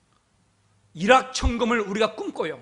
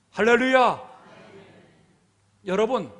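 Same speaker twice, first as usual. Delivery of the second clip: ordinary delivery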